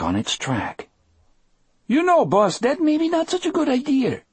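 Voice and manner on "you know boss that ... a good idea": very high, prissy little voice; nervous fusspot piping up timidly to the boss. A narrator's reading. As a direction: fret primly in a squeaky high pitch. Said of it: "in a voice so nervous and prissy".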